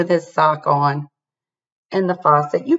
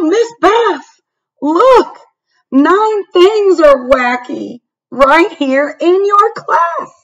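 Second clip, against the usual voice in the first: much higher and louder, urgent call; yelled; 9 semitones higher, 7 dB louder, slower than usual. A narrator's reading. A child calling to Miss Beth, yelling high. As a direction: shout for the teacher's attention. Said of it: "I yelled".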